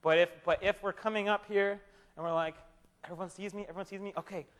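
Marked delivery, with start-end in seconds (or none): 3.0-4.5 much softer, furtive aside to oneself; hushed mock self-check. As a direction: quietly check that everyone is watching, mockingly. Mimics showy worshipper checking onlookers.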